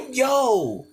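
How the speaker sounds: ordinary talk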